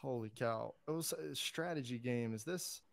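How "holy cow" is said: usual speaking voice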